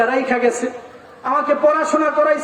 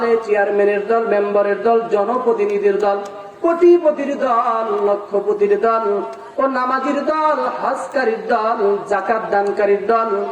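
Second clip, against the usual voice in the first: ordinary delivery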